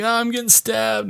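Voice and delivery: high, soft mock distress; pretend attack panic. Caller pretending he's being stabbed, high mock distress. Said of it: "pretending like he's getting attacked".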